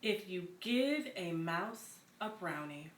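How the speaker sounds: slow, lively storybook read-aloud with little pauses; picture-book narration, unhurried and bright; reading to children. Acted.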